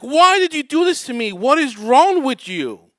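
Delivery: imitated high, very loud outraged yell; screaming reproach. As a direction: wail the accusation at full volume. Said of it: "she's screaming at me".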